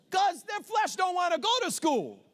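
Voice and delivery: loud, high preaching cry with a laugh; preaching shout. Real, not acted. Preacher rousing the congregation, loud laughing shout. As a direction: shout it out to the church.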